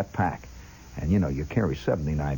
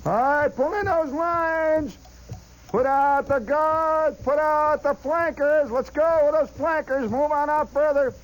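much higher, slow drawn-out barked army orders; sergeant hollering; slower than usual. Retold or imitated. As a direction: call it out long and high like a drill sergeant down the line. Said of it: "the sergeant once in a while hollering".

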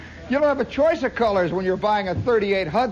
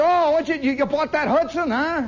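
high, loud startled dad voice; a father caught off guard, voice jumping up; surprised father; 8 semitones higher, 4 dB louder than usual. Retold or imitated. Father hearing his son bought a car, high startled.

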